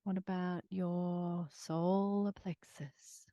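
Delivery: guided meditation. Acted.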